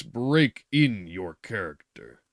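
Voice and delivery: "break in" slow, drawn out and weighty; slow heavy emphasis on "break in"; stressed break in. A narrator's reading. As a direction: lean hard on "break in". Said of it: "laid special stress on the word "break in".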